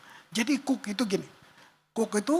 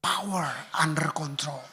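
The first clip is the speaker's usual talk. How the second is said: gentle; 6 semitones lower than usual. Shown as a demonstration. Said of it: "lemah lembut ini".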